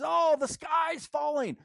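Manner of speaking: high, slow, mocking panicked cry; mock alarm, high and drawn out; panicky worrier. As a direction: ham up a Chicken Little wail.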